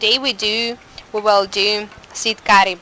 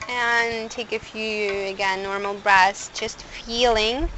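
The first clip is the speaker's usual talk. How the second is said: guided meditation; 4 dB quieter than usual. Acted. Closing guided breathing.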